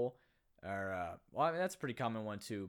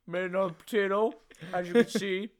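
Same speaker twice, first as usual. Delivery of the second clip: hammed-up higher, louder vlog voice through laughter; mocking Matt Damon's vlog; 7 semitones higher, 12 dB louder than usual. Mocking Matt Damon's Martian vlog in a laughing loud voice. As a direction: parody a chirpy survival video diary. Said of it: "his stupid little vlog".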